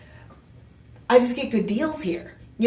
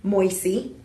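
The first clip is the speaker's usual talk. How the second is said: usual speaking voice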